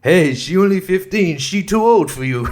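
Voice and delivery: loud, high mock-foreign outburst; Borat. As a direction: burst in with a hammed-up foreign character voice. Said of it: "when Borat runs in".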